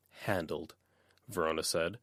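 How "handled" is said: flat, softer, dead tone; cold deadpan; emotionless. A narrator's reading. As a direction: drain every feeling from it. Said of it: "letting the emotion drop out".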